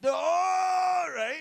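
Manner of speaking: high, loud mock scream of no; loud high panicked cry; panicked yell. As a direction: shriek it like you're caught. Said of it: "And I was like".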